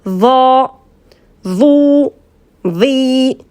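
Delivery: "va, vous, vi" with a heavy voiced TH, tongue between teeth; thick emphatic DH. Shown as a demonstration. Teacher gives the letter with vowels, thick "va, vous, vi". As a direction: make "va, vous, vi" thick and drawn out. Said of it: "comment c'est bien épais".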